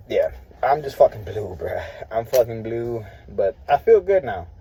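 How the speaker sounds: usual speaking voice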